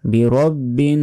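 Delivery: বিরব্বিন with a nasal hum on the n; nasalized ending; with ghunnah. Shown as a demonstration. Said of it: "এখানে গুণনা হবে".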